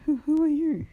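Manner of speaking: put-on high, loud little boy asking; small boy. Boy startled by the hero, high and loud. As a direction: squeak it out like a startled child.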